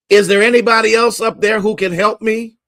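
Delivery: high, loud desperate call; frantic shout. Retold or imitated. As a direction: yell for anyone else to save you. Man rejects the instruction.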